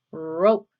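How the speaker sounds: "rope" loud, with a long opening R; "rope" punched as the answer word; emphasised R sound. Shown as a demonstration. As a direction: roll out a held "rrr" into "rope", untrilled. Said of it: "Which word has the R sound?".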